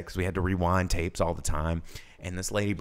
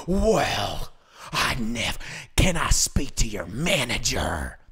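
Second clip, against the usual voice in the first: indignant customer; 3 semitones higher, 3 dB louder, much slower than usual. Retold or imitated. Customer demands manager.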